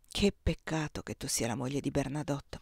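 low, soft murmur; murmured regret. A narrator's reading. Emperor to another man's wife, murmured low. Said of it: "mormorò".

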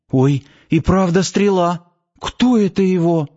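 startled high voice, a touch scared; frightened. A narrator's reading. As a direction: gasp it out in fright. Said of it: "Испуганно стала оглядываться она".

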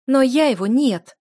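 loud, high, merciless refusal; cutting, pitiless rejection; whip-like retort. A narrator's reading. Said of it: "мои слова были подобны хлысту".

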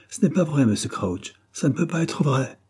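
storyteller's understated alarm; trembling voice. A narrator's reading. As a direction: plead against bad news. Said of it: "dit-il d'une voix tremblante".

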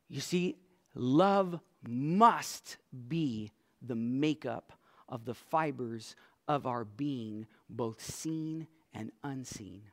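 ordinary talk

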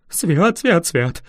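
usual speaking voice

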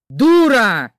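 loud angry shout; husband shouting at his wife; shouted insult. Acted. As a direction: bark the insult at her. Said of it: "выкрикнул Серёжа".

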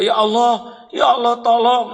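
high, loud frantic cry for help; frantic plea. Retold or imitated. The rarely praying crying to God in a disaster. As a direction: plead with God in panic.

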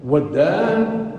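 usual speaking voice

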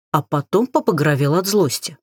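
plain reading voice

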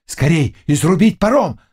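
urgent call, climbing in pitch; crowd crying out. A narrator's reading. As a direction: take up the panicked cry. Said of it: "закричали и другие".

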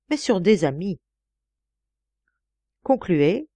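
plain reading voice

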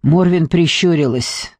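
usual speaking voice

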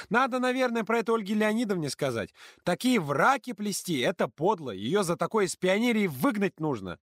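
put-on high, loud girl's disbelief; hiccuping with laughter. A narrator's reading. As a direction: hiccup through leftover laughter. Ksyusha girl voice. (Ксюша икает от смеха)

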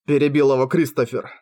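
ordinary delivery

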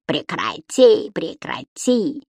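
кривляясь, медленно повторить прекрати; писклявая пародия на Дауме; передразнивание. A narrator's reading. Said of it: "передразнил его Субастик". (Субастик передразнивает визг Дауме, медленно и кривляясь)

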